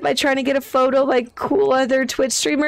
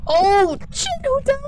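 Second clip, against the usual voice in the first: high shocked outburst; 11 semitones higher than usual. Real, not acted. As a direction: react to a sudden death. Sudden death alert.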